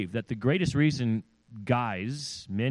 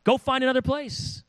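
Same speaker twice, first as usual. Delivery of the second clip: high, firm sendoff; brisk commanding voice; Jesus, firm; 11 semitones higher than usual. Retold or imitated. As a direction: push them back out with firm urging. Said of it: "He's gonna say".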